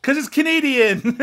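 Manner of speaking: high, loud mock-triumphant reveal; gleeful punchline. Real, not acted. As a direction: deliver it like a gotcha punchline.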